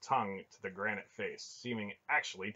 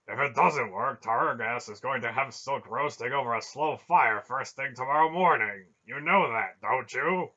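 loud, raised, heated argument; vehement warning; 4 semitones higher, 12 dB louder than usual. A narrator's reading. Barak warning that Silk will die tomorrow, vehement. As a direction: press the danger forcefully, without letting up. Barak warns of Silk's likely fate.

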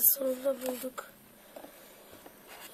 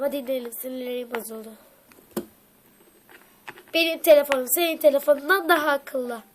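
usual speaking voice

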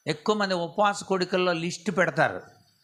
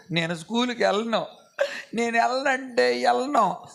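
put-on whiny child refusing; 5 semitones higher than usual. Son refusing school to his parents, mock whining wail. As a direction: whine like a stubborn little boy. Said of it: "అని ఏడుతున్నాడు".

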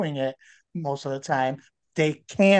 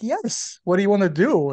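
light mock girl voice, higher and louder; eager girl; 5 semitones higher, 4 dB louder than usual. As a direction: play the keen woman, a little over the top. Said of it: "And she's like".

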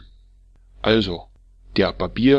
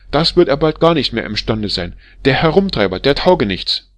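much louder and higher, raging tirade; furious scolding; 4 semitones higher, 7 dB louder than usual. Acted. Wife berating the barber as a drunken scoundrel, furious yelling. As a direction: tear into him like an outraged wife. Wife rejects keeping the nose.

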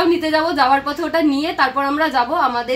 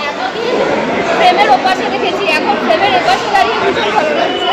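raised, louder excited voice; higher and louder, thrilled to be on this side of the frame; 5 semitones higher, 5 dB louder than usual. Real, not acted. Excited meeting bloggers. (উঁচু, জোরালো উচ্ছ্বসিত গলা)

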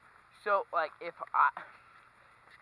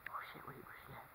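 whispering; 5 semitones lower, 21 dB quieter, faster than usual. Real, not acted. Hiding from her friend.

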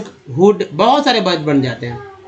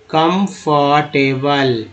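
"Comfortable" very slow, each part held apart; syllable by syllable; 3 semitones lower, much slower than usual. Shown as a demonstration. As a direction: sound out "comfortable" in drawn-out pieces. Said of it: "ABL को एबल". ("Comfortable" धीरे-धीरे, हर हिस्सा अलग)

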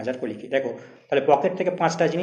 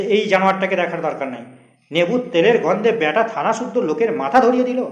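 scornful jibe; 5 semitones higher, 7 dB louder than usual. Acted. Inspector mocking the prisoner.

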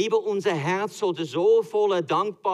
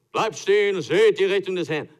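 grand proclamation; 5 semitones higher, 8 dB louder than usual. Acted. Moses before the Red Sea, hammed-up grand proclamation.

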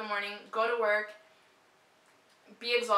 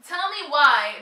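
exasperated; 5 semitones higher, 10 dB louder, slower than usual. Real, not acted. Exasperated outburst about pharmacy.

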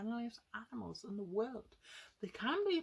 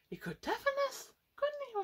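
much higher and louder, mock alarm; excited exaggeration; 4 dB louder, faster than usual. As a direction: play up the danger for the kids. Imagining the lion's roar.

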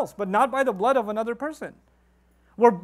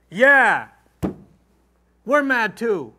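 loud, hammed-up me-too anger; mock indignant joining-in; mocking fake outrage; 6 dB louder, much slower than usual. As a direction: imitate people piling on in borrowed anger. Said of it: "position do we assume".

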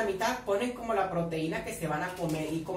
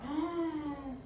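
high, drawn-out gasp; creeped-out gasp; long gasp; 8 semitones higher, 4 dB quieter than usual. Real, not acted. Reacts to something creepy.

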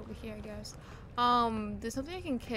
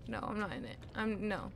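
ordinary talk, nothing marked

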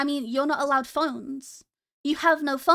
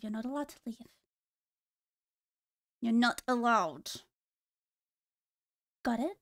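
much softer, low, creepy undertone; softer, menacing; 5 semitones lower, 5 dB quieter than usual. Pretend. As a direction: drop to a quiet, sinister murmur. Same line said twice.

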